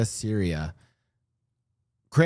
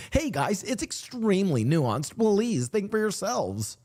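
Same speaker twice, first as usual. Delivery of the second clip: higher, slow, sing-song reading of a comment; mock chat voice; 9 semitones higher, slower than usual. Reading a viewer's comment aloud to chat, high and slow mock voice. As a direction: read the comment in a silly higher voice. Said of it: "I read this in this voice".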